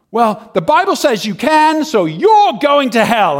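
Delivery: high, loud street-preacher pronouncement; imitated soapbox preacher in full cry; condemning shout. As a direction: hurl the judgment out over a crowd.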